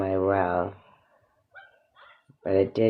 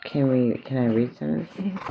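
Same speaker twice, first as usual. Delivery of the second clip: usual speaking voice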